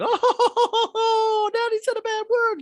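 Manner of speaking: put-on very high, loud tattling child voice; little boy catching dad out; giggling kid. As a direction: squeal it like a delighted little boy. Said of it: "Max, the kid, is like".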